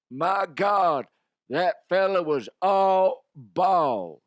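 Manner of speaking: loud, high, drawn-out hollering call; sound-bite exclamation. Shown as a demonstration. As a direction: belt it out slowly like a big play call. Demonstrates a triumphant sound bite.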